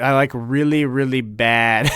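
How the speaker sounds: normal talking voice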